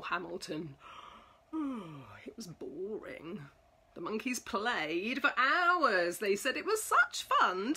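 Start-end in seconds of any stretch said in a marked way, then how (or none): 1.5-3.4 bored whisper. A narrator's reading. Narrator sighing over the pig's long wait, whispered. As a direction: sigh then whisper it was boring.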